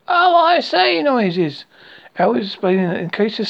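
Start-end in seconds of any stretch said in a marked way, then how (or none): 0.1-1.0 very high, loud little squeal; excited exclamation. A narrator's reading. Piglet jumping up and down to help, high flustered cry. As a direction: exclaim high, like a small creature in a flap. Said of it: "jumping up and down and making a".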